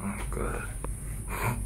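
real faint, voiceless whisper. Real, not acted. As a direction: breathe the oath out, barely audible. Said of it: "Why am I whispering".

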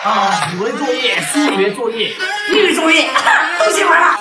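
excited shouting. Acted. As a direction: shout it gleefully. Excited student shouting about homework.

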